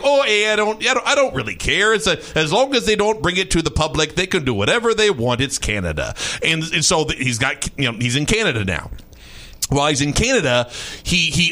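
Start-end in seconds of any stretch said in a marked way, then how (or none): 0.0-6.1 easygoing imitation of a Canadian local; Canadian lumberjack voice. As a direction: play a laid-back Canadian who doesn't care. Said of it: "I look like a Canadian lumberjack".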